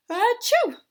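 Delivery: big high sneeze; loud sneeze. Acted. Amos sneezes.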